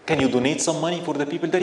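ordinary talk

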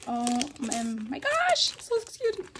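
squeal, then catch yourself; awkward joy. Real, not acted. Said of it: "Sorry guys, I'm just so excited".

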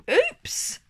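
high surprised oops; mild storybook surprise. A narrator's reading. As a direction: pop out a startled oops. Narrator exclaims at mishap.

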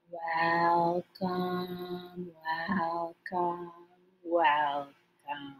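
as slow as we can. Shown as a demonstration. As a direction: stretch each one out slowly. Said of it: "as slow as we can".

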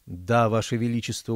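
ordinary delivery, nothing marked

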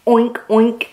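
ordinary delivery

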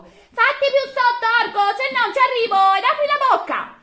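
high, loud call down from the tree; a boy in a fig tree yelling to the ogre. A narrator's reading. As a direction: shout up high to the ogre below. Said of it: "gridò all'orco".

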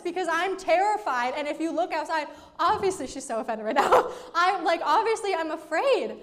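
hammed-up disbelief at being doubted; indignant mock terror. Speaker protesting to the calm friend in the storm, mock-terrified.

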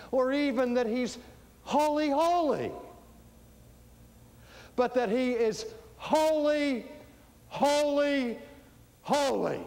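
high, loud, each word set apart; ringing proclamation. Real, not acted. Preacher proclaiming the threefold holy, ringing and forceful.

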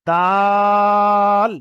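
দাল stretched long, tongue touching only at the end; correct dal. Shown as a demonstration. Teacher demonstrating correct দাল for students. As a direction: draw দা out loud, then land the ল. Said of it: "যেমন".

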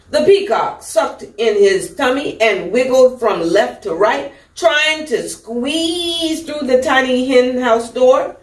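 usual speaking voice

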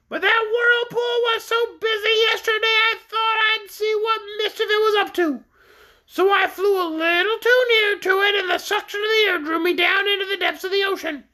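very high, squawky orc voice; high-pitched creature recounting its troubles; shrill creature. A narrator's reading. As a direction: tell it in a piercing bird voice.

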